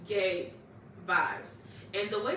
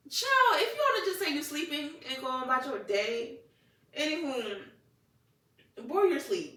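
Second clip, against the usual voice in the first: higher, exasperated telling-off; mock scolding; 6 semitones higher, 4 dB louder than usual. Telling the boy to admit he was sleeping, mock scolding. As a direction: call him out with playful exasperation.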